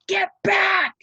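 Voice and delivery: steward's high, loud yell at the crowd; shout. Acted. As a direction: bark the crowd back from the gate. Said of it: "the steward shouted".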